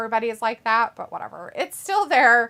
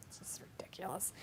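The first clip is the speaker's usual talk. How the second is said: whispering; 3 semitones lower, 21 dB quieter than usual. Real, not acted. Disgusted aside about the title.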